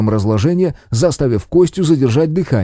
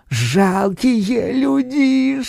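eerie spirit voice; 6 semitones higher, 5 dB quieter, much slower than usual. Acted. Evil spirit threatens people.

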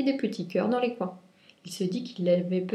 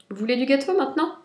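higher, loud and quick; brisk cheerful offer; 6 semitones higher, 6 dB louder, faster than usual. A narrator's reading. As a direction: hurry on to the cake. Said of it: "dit Lapin".

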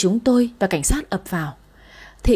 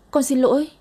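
higher, breaking plea; daughter's apology rising higher through tears; rising tearful apology; 5 semitones higher than usual. A narrator's reading. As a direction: push the apology up into a cry. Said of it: "vừa sợ vừa khóc nức nở".

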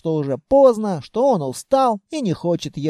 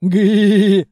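loud drawn-out groaning howl; feigned wail of pain; hammed-up wail; 6 dB louder than usual. A narrator's reading. Patient howls in the shaken barrow, hammed-up wail. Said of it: "вопил я".

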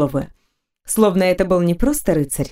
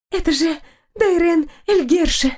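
put-on excited girl; 9 semitones higher than usual. A narrator's reading. Gossiping girl recognizes knight.

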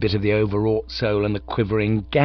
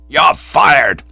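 loud, raised-pitch bellow; 6 semitones higher, 11 dB louder than usual. A narrator's reading. Manager sacking Cyril, a furious bellow. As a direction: bellow the dismissal. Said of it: "bellowed old Blumenfield".

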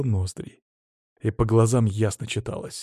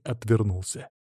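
ordinary delivery, nothing marked

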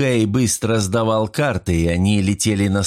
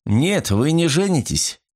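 higher, emphatic refusal; stern contradiction, pitched up; sharp exclamation; 7 semitones higher than usual. A narrator's reading. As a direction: cut in firmly, stretching the words. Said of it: "резко воскликнул Темпест".

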